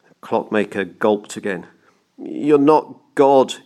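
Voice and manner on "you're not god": slow, raised, hesitant protest; stammering. A narrator's reading. Clockmaker daring to contradict the banker, stammering. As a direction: falter through it slowly, higher and louder. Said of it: "he stammered".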